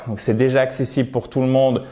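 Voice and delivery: ordinary delivery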